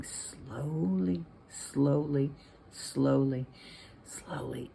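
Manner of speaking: drawn-out words, fading to a hush; creeping slowly. A narrator's reading. Gordy crawls through the vent, slow creeping delivery. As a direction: stretch each word like a careful crawl.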